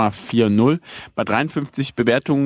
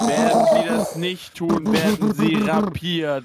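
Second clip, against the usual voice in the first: air raid announcement; 7 semitones higher, slower than usual. Pretend. Their own homemade air raid sound effect.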